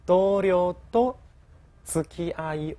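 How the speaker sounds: 同僚と said slowly, word by word; slow model reading. Shown as a demonstration. Teacher reading the example sentence slowly for students. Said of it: "我们可以这样说".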